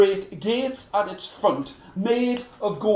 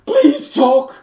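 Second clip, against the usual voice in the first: louder, higher Please talk; 6 semitones higher, 10 dB louder than usual. Acted. The king begs the silent horse, loud and high. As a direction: demand it out loud.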